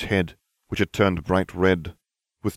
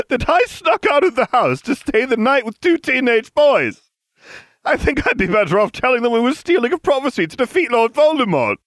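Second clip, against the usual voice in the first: very high, loud character voice; indignant character; 6 dB louder than usual. Acted. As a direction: protest loudly in a high-pitched voice.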